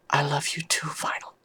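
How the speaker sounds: hushed, tender whispered reply. Acted. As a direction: whisper it back, fading away on the name. Whispered reply to love confession.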